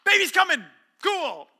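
put-on "Baby's coming" said high and loud, casually upbeat; breezy nurse. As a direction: toss "Baby's coming" off like obvious news. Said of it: "They look at you and go".

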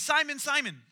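calling out. Retold or imitated. Jesus calling Peter by name.

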